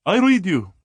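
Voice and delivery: a hint of a thick Indian accent over the radio. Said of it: "The accent was so thick".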